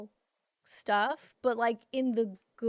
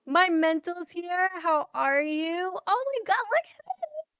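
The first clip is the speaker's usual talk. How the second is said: thrilled greeting; 8 semitones higher, 5 dB louder than usual. Real, not acted. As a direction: squeal with joy at a friend's arrival. Thrilled as another friend appears.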